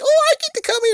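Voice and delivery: very high squeaky mock girl voice; ditzy little sister, way up high. Mocking the dumb sister in a squeaky voice. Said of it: "and you're like".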